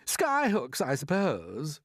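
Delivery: high, loud mocking jab; jeering. A narrator's reading. Centipede jeering at James's plan, mocking. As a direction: sneer at the idea. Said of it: "jeered the centipede".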